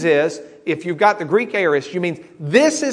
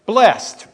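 "Blessed" alone, higher and louder; emphasis on "Blessed"; 5 semitones higher, 5 dB louder than usual. Real, not acted. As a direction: set "Blessed" apart with a firm, raised punch. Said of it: "I want to emphasize the word blessed".

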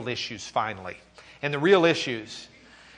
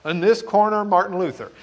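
higher, louder fight announcer call; ring announcer; 6 semitones higher, 8 dB louder than usual. Pretend. Teacher announcing Luther like a prizefighter, announcer voice. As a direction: introduce him like a boxing match.

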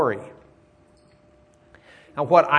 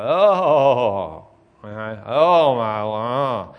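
mock wailing; 6 dB louder, much slower than usual. Shown as a demonstration. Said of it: "the Hebrew word for wailing".

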